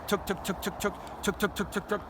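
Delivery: soft, quiet tuk tuk clucks; feeding cluck tuk. Shown as a demonstration. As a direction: cluck softly like a feeding hen. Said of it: "And you accomplish that".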